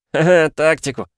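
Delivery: mocking laugh through the words. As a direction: laugh at the idea. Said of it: "залился смехом Никитос".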